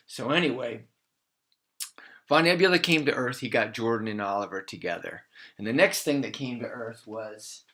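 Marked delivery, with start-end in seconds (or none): none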